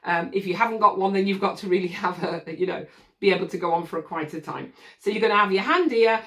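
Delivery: ordinary talk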